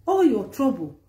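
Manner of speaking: ordinary talk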